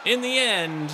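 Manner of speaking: booming, slowed-down arena announcer, loud and grand. Acted. Streamer narrating his gladiator fight, like a loud arena announcer. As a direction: call the fight like a gladiator games herald.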